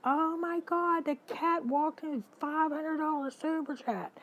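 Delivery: high loud mock freak-out; retold panic. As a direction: relive the panic as the cat nearly sends money. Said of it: "I was freaking out".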